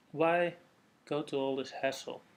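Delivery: ordinary talk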